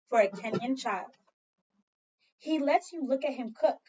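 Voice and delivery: ordinary talk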